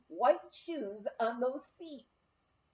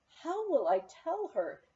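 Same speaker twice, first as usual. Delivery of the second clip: plain reading voice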